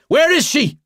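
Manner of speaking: shouted high and loud, demanding; angry cry. A narrator's reading. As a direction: yell it down the hallway. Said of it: "I cry".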